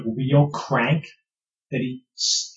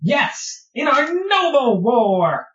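slow, higher proud claim; a much higher, slower noble boast; proud kobold; 10 semitones higher, slower than usual. Acted.

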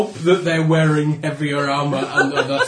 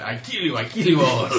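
put-on comedy Mexican accent. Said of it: "in the comedy Mexican accent".

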